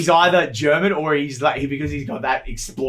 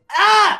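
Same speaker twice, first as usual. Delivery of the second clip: sudden high yelp; startled yelp; 7 dB louder than usual. Acted. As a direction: yelp in fright. Dog freaks out.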